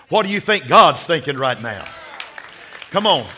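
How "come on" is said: ordinary talk, nothing marked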